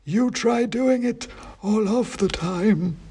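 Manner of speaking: high, slow, dusty oracle voice; dusty faint ancient head. A narrator's reading. Ancient king's head answering the wizard, slow high dusty chant. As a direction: intone it like a faint, dusty myth. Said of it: "said Bran".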